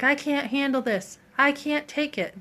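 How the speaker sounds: the narrator mimicking the stressed teacher, mild exasperation; mock frazzled teacher. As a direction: imitate a teacher losing it, lightly. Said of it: "And she's like".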